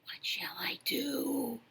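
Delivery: slow, soft breathy whisper. Acted. Rabbit with a bee in his throat, whispering. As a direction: whisper the question, slowly. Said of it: "he whispered to a squirrel".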